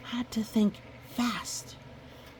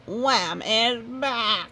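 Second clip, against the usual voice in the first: very slow, louder dramatic reveal; ominous reveal; 4 semitones higher, 4 dB louder, much slower than usual. Acted. Goose telling Wolf the monster is back, slow and ominous. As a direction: drop it slowly like doom.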